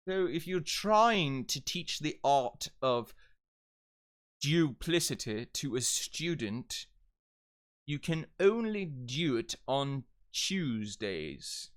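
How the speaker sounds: slow, deliberate demo British English; exaggerated British with fluid J glides; British accent. Shown as a demonstration. Sample sentence in British dialect.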